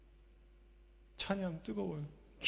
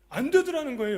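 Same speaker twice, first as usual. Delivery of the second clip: 안 되더라는 거예요 thrown out higher and louder; preached emphasis; 10 semitones higher, 10 dB louder than usual. Shown as a demonstration. Preacher stressing to the congregation that it did not work, loud. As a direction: hammer 되더라는 home.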